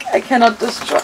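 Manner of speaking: normal talking voice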